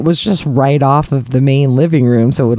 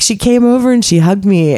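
ordinary talk, nothing marked

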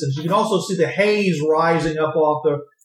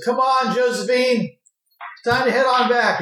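driver calling his horse; 7 semitones higher than usual. Acted. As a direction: coax the horse to head home.

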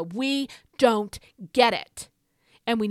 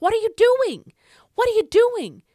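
high, loud preacher's cry; impassioned shout; 12 semitones higher, 8 dB louder than usual. Real, not acted. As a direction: shout the question at the congregation. Preacher confronting listeners, rising fury.

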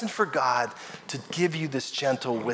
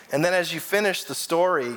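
normal talking voice

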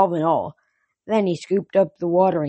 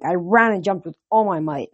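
plain reading voice

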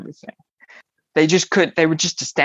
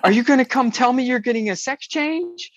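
higher, incredulous mock outburst; exclamatory question; 9 semitones higher than usual. Friend texting a guess at the news, exclamatory. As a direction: read a text out with its exclamation mark. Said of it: "with the exclamation point".

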